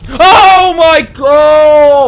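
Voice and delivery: very slow, loud drawn-out scream; terrified shout. Real, not acted. Streamer caught by the monster, screams. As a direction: howl in fright.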